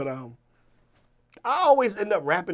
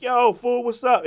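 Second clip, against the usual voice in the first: raised, loud hail to a buddy; excited friend's voice, pitched up; imitated video greeting; 9 semitones higher, 9 dB louder than usual. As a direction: call out to a friend on camera.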